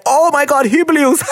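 high, delighted gasp of praise; amazed. Retold or imitated. Delighted reaction to his partner's production. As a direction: blown away by the edit. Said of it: "I'm like".

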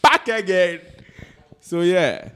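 put-on loud, high Ghanaian-accented greeting. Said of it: "Back to the Ghanaian accent".

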